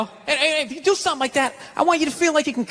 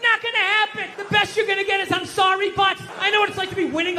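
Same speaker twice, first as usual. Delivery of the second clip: high and exasperated, pushing the complaint; worked-up rant; 9 semitones higher than usual. Acted. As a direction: wind yourself up, higher and higher. Ranting about living with women.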